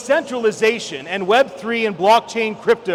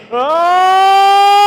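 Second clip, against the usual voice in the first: long, high, loud frightened scream; scared scream; 10 semitones higher, 9 dB louder than usual. Retold or imitated. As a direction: let the joy flip into terror. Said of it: "it quickly became".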